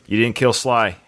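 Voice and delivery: usual speaking voice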